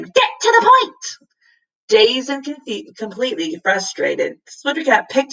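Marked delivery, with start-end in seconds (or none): 0.0-1.1 very high, loud squawking cry; bird's shriek. A narrator's reading. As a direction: screech it again, even higher. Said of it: "shrieked again".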